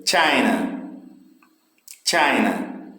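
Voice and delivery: CHINA with a long, strong first syllable, falling away; stress on first syllable. Shown as a demonstration. Teacher drilling syllable stress for students, first syllable of China stressed. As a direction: lean on the start of "China". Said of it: "O início China tem mais força".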